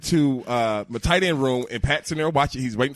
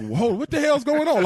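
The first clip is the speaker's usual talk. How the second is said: retold shock; 13 semitones higher than usual. Finding locker cleaned out.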